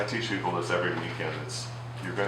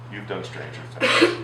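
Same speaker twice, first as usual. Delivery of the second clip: teasing; 5 dB louder, slower than usual. Acted. Teasing joke to workshop audience.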